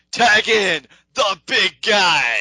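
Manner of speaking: high, loud, drawn-out shout; hammed-up slow wrestler yell; Ryback. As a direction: bellow it like a showboating wrestler. Acts out Ryback demanding the tag.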